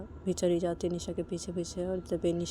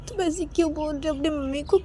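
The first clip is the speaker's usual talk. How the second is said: high-pitched reproachful question; 8 semitones higher, 3 dB louder than usual. Acted. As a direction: challenge her in a raised pitch. Manisha निशा को टोकती है. (उलाहना भरी ऊँची आवाज़)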